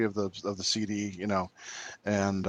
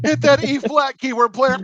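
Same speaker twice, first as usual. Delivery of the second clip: high, loud teasing call; mock cheer; 11 semitones higher, 6 dB louder than usual. Teasing his friend with a high loud mock shout. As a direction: egg him on like a fan yelling at the stage.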